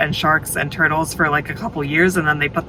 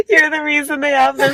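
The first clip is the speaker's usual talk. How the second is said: laughing; 11 semitones higher than usual. Real, not acted. Teasing friend, both laughing.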